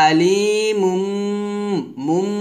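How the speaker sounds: slow "আলিমুম" with the last m hummed; ghunna demonstration. Shown as a demonstration. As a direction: draw out "আলিমুম" into a closed-lip hum. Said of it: "তাহলে হবে". ("আলিমুম" টেনে, শেষে গুন্না)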